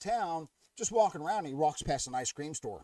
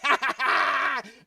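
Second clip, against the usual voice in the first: high, loud burst of laughter; laughing at own joke; 10 semitones higher, 7 dB louder than usual. Real, not acted. Host laughing at his own penguin joke, high burst. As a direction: crack up at your own punchline.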